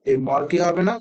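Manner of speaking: usual speaking voice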